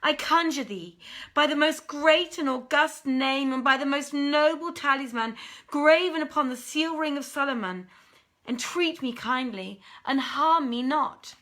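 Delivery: loud, high desperate entreaty; pleading Ifrit. Acted. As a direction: beg her for mercy at full voice. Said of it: "he implored her forbearance".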